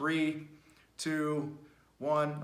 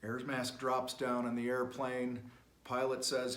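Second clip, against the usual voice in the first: ordinary talk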